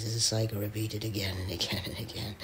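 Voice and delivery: rushed whispered aside; quick secretive whisper; fast whisper. Acted. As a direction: hurry it out under your breath.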